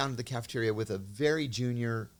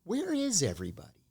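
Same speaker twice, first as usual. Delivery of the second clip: higher, lightly voiced puzzled colleague; puzzled junior officer; 9 semitones higher than usual. Junior officer puzzled by the empty cafeteria, voiced higher. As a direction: ask it like a clueless young officer, pitched up. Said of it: "she looks around and she goes".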